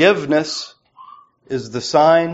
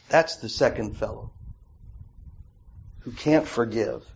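much softer, lower aside; sudden hush; 3 semitones lower, 8 dB quieter than usual. Real, not acted. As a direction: drop to a grave near-whisper. Drops voice after climax.

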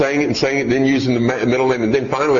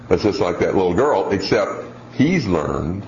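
ordinary talk

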